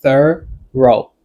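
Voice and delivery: "thorough" split as THUR, pause, OH; syllable breakdown. Shown as a demonstration. Teacher showing two syllables, "thorough" pulled apart. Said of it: "This word has two syllables".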